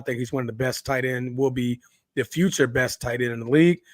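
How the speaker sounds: ordinary delivery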